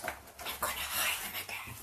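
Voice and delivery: whispered secret; whispering. Real, not acted. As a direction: whisper it conspiratorially. Hiding treats from husband.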